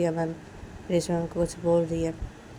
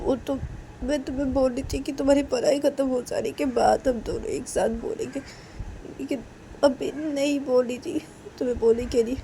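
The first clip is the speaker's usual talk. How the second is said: timid Reshma; 7 semitones higher, 4 dB louder than usual. Acted. Reshma explaining to Kajal, higher timid voice.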